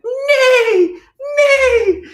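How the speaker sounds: very high, loud laughing neigh; whinny it out, laughing; very high giggly horse neigh. Pretend. Horse sound in children's song.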